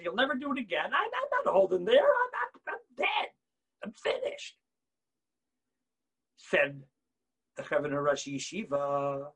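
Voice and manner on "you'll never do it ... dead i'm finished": higher, light mock despair; despairing student. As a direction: whine like a hopeless student.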